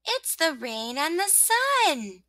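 high, slow announcement; sun's little voice. Acted. Sun telling rosebud who is there, drawn out. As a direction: sing it out high, words stretched.

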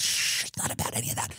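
whispered shh, hushing someone; hushed whisper. Retold or imitated. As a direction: whisper it down like a secret. Hushing voice in acted quarrel.

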